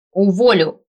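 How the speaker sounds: loud, abrupt angry shout; barked threat. A narrator's reading. Boss threatening her assistant as he leaves, angry bark. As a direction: bark it after someone leaving. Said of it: "рявкнула я вслед".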